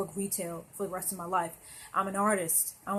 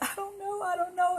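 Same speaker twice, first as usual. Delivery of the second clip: much higher, tearful mock sob; crying; 11 semitones higher than usual. Retelling crying to the manager, high tearful pleading. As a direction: replay the helpless crying words high and pinched. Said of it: "And I was crying and everything".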